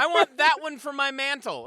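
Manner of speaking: loud high mock snobbish demand. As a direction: play the cartoon colonial villain wanting a trophy. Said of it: "And she's just like".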